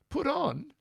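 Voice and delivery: high incredulous echo question; mock-astonished retort; indignant disbelief. Indignant retort in retold exchange.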